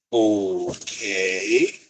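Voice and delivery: drawn-out okay, sliding up at the end; puzzled reaction. Retold or imitated. Classmates looking at each other as the new student arrives, puzzled reaction. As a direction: stretch it out in mock disbelief.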